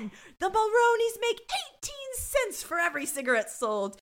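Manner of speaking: high, put-on gossipy Munch voice. Cohost imagines Munch gossiping, high silly voice.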